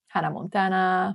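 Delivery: ordinary delivery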